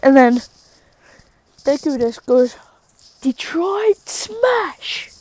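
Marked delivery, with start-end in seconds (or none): 3.2-5.1 high, drawn-out attack yell; slow, high mock war cry; acted battle cry. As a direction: stretch out the move name as a hero's cry.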